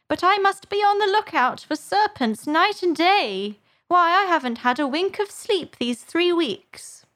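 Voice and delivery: high, put-on bird voice, complaining; aggrieved pigeon. A narrator's reading. Pigeon troubled by hatching eggs, aggrieved. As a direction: bewail your lot in a high voice. Pigeon lamenting lack of sleep.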